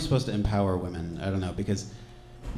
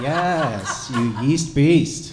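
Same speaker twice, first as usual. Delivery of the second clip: mock hype; 3 semitones higher, 5 dB louder, much slower than usual. Male feminist cheers a woman on, hammed-up enthusiasm. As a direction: stretch the praise out loudly.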